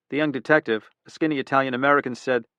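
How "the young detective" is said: usual speaking voice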